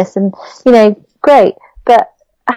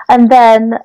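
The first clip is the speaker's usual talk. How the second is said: ordinary delivery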